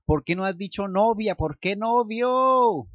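high, loud, slow frantic cursing; panicked self-scolding. Acted. Luke yelling at his brain for saying boyfriend, frantic. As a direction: yell at your own brain in horror.

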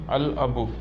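"al abu" with a clearly sounded l; lam pronounced. Shown as a demonstration. Teacher showing learners a moon-letter word, l pronounced in "al abu". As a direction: say "al abu", keeping the l of the article. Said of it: "pronouncing lam".

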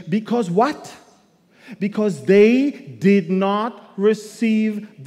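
forceful Bible reading from the pulpit; scripture reading. Acted. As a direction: proclaim the verse to the congregation. Preacher reads scripture.

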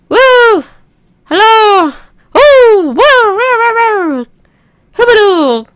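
high, loud nonsense hollering; silly whooping. Pretend. Player making noise to push the coaster up, high hollering.

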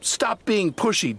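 high, slow cross snap; snapping. Acted. Donald snapping at Douglas after the crash, cross. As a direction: bite the words at your twin. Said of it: "Donald snapped".

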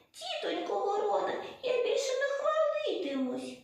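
high-pitched scared pleading; frightened hare. A narrator's reading. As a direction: plead with the crow in a tiny high voice. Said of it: "злякався". (переляканий тоненький голос зайця)

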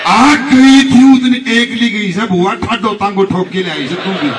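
slow, loud, drawn-out jibe; slow, loud mock scolding from the uncle; mocking retort. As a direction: rub the girl's nose in it.

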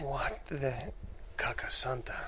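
breathless whispered curse; a stunned aside under the breath; shocked whisper. Acted. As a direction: hush it out in disbelief. Shocked priest mutters in disbelief.

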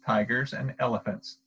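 usual speaking voice

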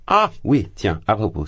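high, loud pleased exclamation; as if struck by a happy idea; sudden delight. A narrator's reading. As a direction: Porphyri pretending to remember something, high and loud. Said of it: "comme soudain heureux".

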